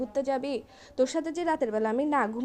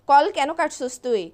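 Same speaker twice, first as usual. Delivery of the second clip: angry demand; 8 dB louder, slower than usual. Acted. Angry girlfriend scolding on phone.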